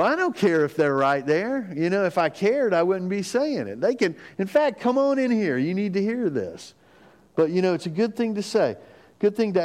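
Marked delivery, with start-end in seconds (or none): 0.0-6.7 a touch of a playful mock-defiant dad; unbothered dad. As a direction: boom out that they can all come hear. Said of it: "I go".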